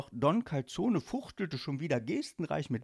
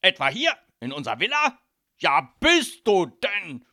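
loud, high alarm, stretched at the end; panicked outburst; 7 semitones higher, 12 dB louder than usual. Acted. Father fears snakes in his villa, loud panic. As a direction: demand it in rising panic.

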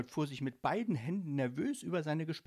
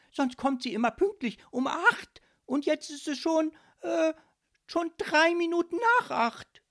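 high, loud, outraged complaint; indignant; 9 dB louder than usual. A narrator's reading. Ghost fuming over three minutes' delay, indignant. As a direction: fume at the top of your voice. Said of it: "antwortete er empört".

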